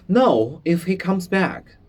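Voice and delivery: panicky, glancing at the door; frightened. Acted. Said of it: "shaking his head, looking towards the door".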